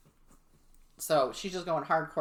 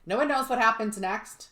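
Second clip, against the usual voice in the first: ordinary delivery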